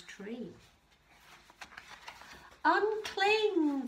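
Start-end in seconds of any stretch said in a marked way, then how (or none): 2.6-3.9 high, loud crowd cry; shouted. A narrator's reading. Crowd shouting up to Kevin in the tree, shouted. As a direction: shout it up as an urgent order. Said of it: "the crowd shouted".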